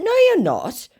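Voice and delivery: very high acted retort; mock contradiction. Acts her contradiction.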